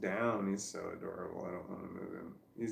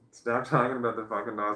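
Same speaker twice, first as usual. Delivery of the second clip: louder scold broken by a laugh; 2 semitones higher, 7 dB louder than usual. Pretend. To herself about the sleeping dog, laughing scold. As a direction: scold yourself but crack up.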